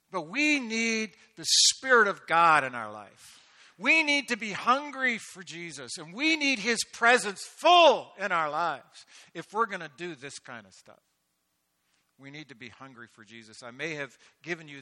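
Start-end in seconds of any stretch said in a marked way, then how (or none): 0.1-11.0 loud, higher, slow and emphatic; impassioned pulpit call; full-force preaching. Real, not acted. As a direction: hammer the need home.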